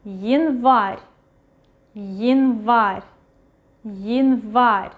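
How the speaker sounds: январь with я reduced to a short yi, stress on the last syllable; reduced initial Ya. Shown as a demonstration. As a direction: say январь as yin-VAR, slowly modelled for learners. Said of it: "we read it as Y".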